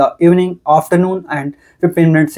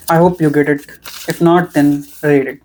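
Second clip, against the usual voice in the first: ordinary delivery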